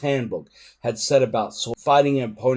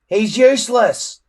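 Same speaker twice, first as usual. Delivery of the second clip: high, loud gloating jeer; gleeful crowing; 10 semitones higher, 8 dB louder than usual. A narrator's reading. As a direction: crow over his failure. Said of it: "crowed Snotlout gleefully".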